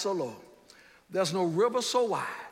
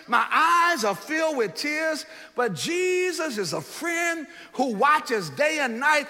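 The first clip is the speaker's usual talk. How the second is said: higher, louder, slow preached hymn verse; verse again, stronger; 5 semitones higher, 4 dB louder than usual. Shown as a demonstration. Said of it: "Let me say that one more time".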